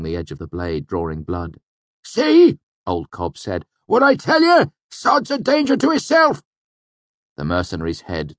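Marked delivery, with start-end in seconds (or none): 2.0-2.6 put-on high, loud rustic old-timer voice; old villager scolding a friend to back off; old village man. A narrator's reading. Old Cobb's character voice. 3.9-6.4 put-on high, loud rustic old-timer voice; old villager scolding a friend to back off; old village man. A narrator's reading. Old Cobb's character voice.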